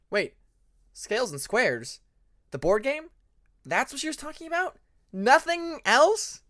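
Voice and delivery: high, incredulous questions; disbelieving. Acted. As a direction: stretch out the disbelief. Disbelieving realization.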